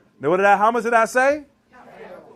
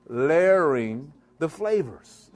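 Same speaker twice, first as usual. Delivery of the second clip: "Layering the flavors" drawn out slowly, lower, each word weighted; slow emphatic repeat; 4 semitones lower, much slower than usual. Shown as a demonstration. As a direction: spell it out slowly for the students. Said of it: "What I say?".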